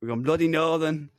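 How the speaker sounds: uncertain mock-northern English accent; wandering northern accent, leaning into "bloody northern"; put-on northern accent. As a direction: slip into a hammed-up northern accent. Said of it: "I don't know what the fuck this accent is. Apparently, I've gone northern".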